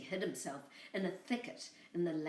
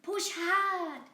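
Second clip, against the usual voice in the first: high bleating lamb voice, louder; 11 semitones higher, 5 dB louder than usual. Acted. Lamb tricking the coyote, high bleating voice. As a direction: bleat the words like a little lamb. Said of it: "said Boragita".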